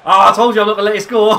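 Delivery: fast, high gloating shout with laughter; triumphant shout. Real, not acted. Player gloating after blocking the shot, triumphant shout.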